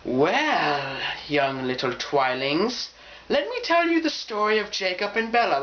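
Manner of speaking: put-on slow, grand storyteller to young fans; Twihard elder storyteller. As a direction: hold court like a revered elder, hammed up. Acting Twihard king or queen.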